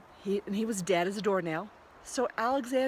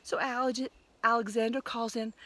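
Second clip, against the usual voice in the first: ordinary delivery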